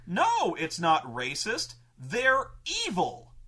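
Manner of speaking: the hardliner waving off the racism charge; mock indignant hardliner. Player arguing to kill the orcs, loud and insistent.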